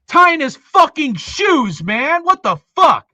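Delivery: loud, high-pitched, real exasperation; exasperated outburst. Real, not acted. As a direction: erupt in disbelief, hitting the swear words hard. Exasperated rant about untied shoes.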